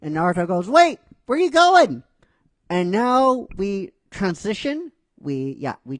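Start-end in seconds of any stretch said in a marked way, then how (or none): 0.7-2.0 much higher, louder startled call; alarmed call. Retold or imitated. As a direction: call after her in alarm. Naruto calls after Ino.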